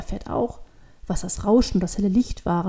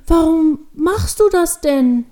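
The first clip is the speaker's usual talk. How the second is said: high, loud, drawn-out question; astonished little girl demanding to know why; shocked surprise; 7 semitones higher, 7 dB louder, slower than usual. A narrator's reading. Devil girl sees horns burning, shocked and astonished. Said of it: "Vor Überraschung reißt Luzifer die Augen auf".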